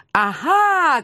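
high, gleeful gotcha; triumphant cry. A narrator's reading. Woman catching out the cat as they wrestle, crying out triumphantly. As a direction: cry out high, without much extra volume. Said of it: "кричала я".

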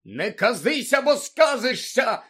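high, loud, drawn-out protest; shouting back, Мандангус. A narrator's reading. Lookout shielding his head, shouting back. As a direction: yell back under attack. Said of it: "закричав у відповідь Мандангус".

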